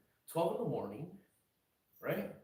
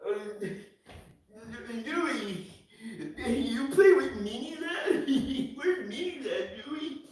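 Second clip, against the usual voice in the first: much higher, loud, slow whiny asking; mocking a viewer; 10 semitones higher, 6 dB louder, slower than usual. Streamer mimics a fan pestering him about Mini Lad, high and whiny. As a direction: whine the question like an annoying fan. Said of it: "you're just like".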